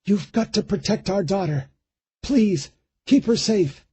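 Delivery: put-on a wife begging him to protect their child; dead wife's voice pleading in his head; Eleanor's voice. A narrator's reading. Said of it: "Eleanor's voice in his head".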